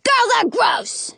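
very high, slow squeaky taunt; squeaky child's jeer; little boy yelling. A narrator's reading. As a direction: yell it as a bratty small boy.

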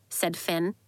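ordinary delivery

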